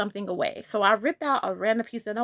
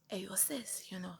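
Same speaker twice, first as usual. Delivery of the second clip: put-on whispered Jamaican-accented alter ego; 6 semitones lower, 19 dB quieter than usual. Revenge self nudging her to act, whispered Jamaican accent. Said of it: "I don't know why I have this accent for her".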